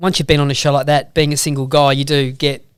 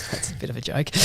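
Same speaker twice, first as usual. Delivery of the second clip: laughing; 4 dB quieter, faster than usual. Real, not acted. Joking about not complaining.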